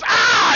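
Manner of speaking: high, loud "Ah!" of alarm; sudden high cry, guarding against attack; startled yell. Acted. Game master voices Verlaine as the ghost attacks, startled yell.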